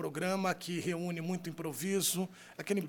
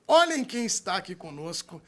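hype announcer; 5 semitones higher, 6 dB louder than usual. Acted. Hyping the guests.

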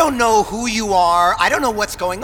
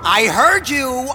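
irritated snap; 5 semitones higher, 4 dB louder than usual. Acted. Annoyed at Otis repeating.

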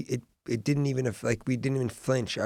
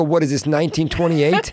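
louder, raised scoffing question; mock incredulity; 4 semitones higher, 6 dB louder than usual. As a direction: scoff at an outdated uncle. Scoffing at request for pencil.

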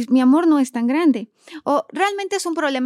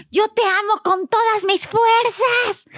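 slow, much higher and strained, love words in anger; mock-angry "te amo", slow and pitched up; angry declaration of love; 9 semitones higher, much slower than usual. Shown as a demonstration. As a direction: say I love you as if furious. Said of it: "la emoción predominante es la ira".

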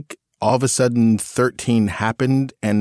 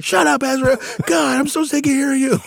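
put-on much higher, whining teenage daughter; whiny teen outburst. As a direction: throw a bratty teen tantrum at dad. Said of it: "he's trying to explain".

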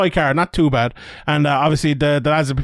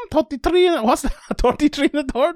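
higher, quizzical echo of the phrase; amused disbelief; 10 semitones higher than usual. Retold or imitated. Co-host echoing Irish phrasing in amused surprise. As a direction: question it as if it sounds funny. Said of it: "Is that an Irish accent?".

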